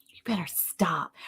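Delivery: mock warning whisper. Teasing whisper at host.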